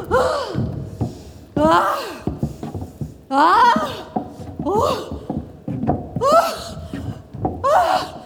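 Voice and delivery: panicked gasping breaths; hyperventilating. Acted. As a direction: gasp for air in a panic. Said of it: "in iperventilazione".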